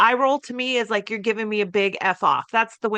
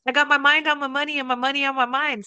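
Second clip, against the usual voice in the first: higher, swaggering playful lyric quote; cocky son talking his way out; cheeky quip; 5 semitones higher than usual. Retold or imitated. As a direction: son joking to break the ice, swaggering quip. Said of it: "he would say".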